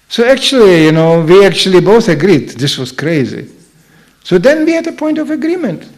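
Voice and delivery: usual speaking voice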